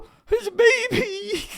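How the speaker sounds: very high, slow crying wail; very high, slow, loud mock sob. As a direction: sob it out as if broken. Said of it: "I'm sitting there going".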